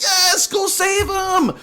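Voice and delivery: high, slow, excited cheer; rally the rescuers on screen; drawn-out cheer. Retold or imitated. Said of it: "I was like".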